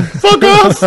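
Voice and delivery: high, loud yell through laughter; mock angry shout. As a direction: yell it in play, half laughing. Joke shouted about throwing kids.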